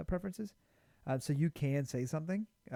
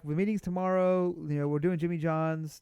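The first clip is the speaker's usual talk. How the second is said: loud, raised mock-announcer delivery; imitated announcement; 4 semitones higher, 6 dB louder than usual. Coworker announcing lunch plans to everyone, calling out. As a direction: mimic someone briskly informing the team.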